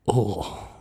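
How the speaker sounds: a lightly done, loud alien viceroy voice; a loose stab at the scheming viceroy reading a business letter; Viceroy Nute Gunray. Acted. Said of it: "Viceroy Newt Gunray instead".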